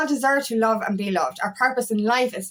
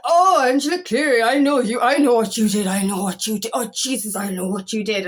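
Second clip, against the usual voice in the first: put-on Irish accent. Irish woman in the pub accusing the speaker, Irish accent. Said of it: "and she was Irish".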